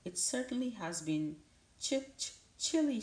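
high, stuttering owl, teeth chattering; shivering owl. Acted. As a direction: stammer it out, shivering with cold. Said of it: "swoopered the owl".

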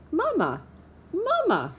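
put-on high, squeaky baby-bird chirp; peeping gosling. A narrator's reading. Newborn gosling calling the robot mama, high peeping voice. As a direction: peep it out like a tiny hatchling. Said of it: "peeped the gosling".

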